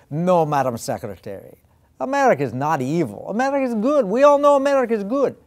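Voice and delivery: playing a KGB officer in a cartoon Russian accent; terrible Russian accent. KGB officer rebuts the Secretary of State, in a hammy Russian accent. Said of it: "this is a terrible Russian accent".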